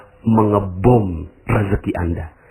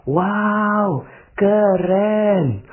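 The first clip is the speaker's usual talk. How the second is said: admiring wow; 9 semitones higher, much slower than usual. Acted. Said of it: "coba Anda ngomongnya gini".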